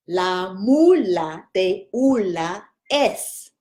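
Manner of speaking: very slow, syllable by syllable; slow practice. Shown as a demonstration. Teacher repeating the twister very slowly for students. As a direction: drill the twister at half speed. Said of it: "Let's try that again".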